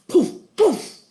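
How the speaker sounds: two high, loud puffs; magic poofs. Acted. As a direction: pop out two spells in a row. Two spells go off.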